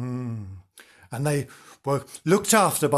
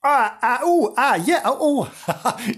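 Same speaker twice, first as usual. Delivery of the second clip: startled exclamations; 9 semitones higher, 4 dB louder, slower than usual. Real, not acted. Startled answer, laughing.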